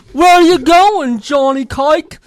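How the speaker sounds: loud, high put-on English accent; high-pitched mock English workman. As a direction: call out in a hammed-up English accent. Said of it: "Well, this is England, okay?".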